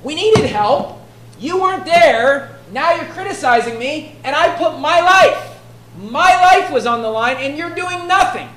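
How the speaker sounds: loud, high, emphatic retort; Jephthah's indignant protest. Retold or imitated. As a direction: protest as the wronged leader, punching each grievance. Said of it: "what Jephthah is saying is".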